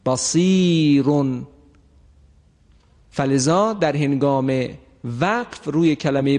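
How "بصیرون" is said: بصیرون slow and drawn out; slow recitation. Shown as a demonstration. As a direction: recite بصیرون slowly, word by word. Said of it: "میگوییم". (بصیرون را آهسته و کشیده بخوان)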